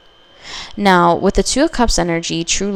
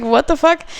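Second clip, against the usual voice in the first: shocked; 8 semitones higher, 3 dB louder than usual. Retold or imitated. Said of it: "I was just like".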